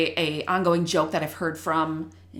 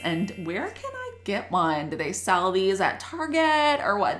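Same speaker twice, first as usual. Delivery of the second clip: naive new teacher; 2 semitones higher than usual. Retold or imitated. New teacher wondering about her colleague's magic wand, naive and puzzled. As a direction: wonder it aloud like a baffled newcomer. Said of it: "So I was like".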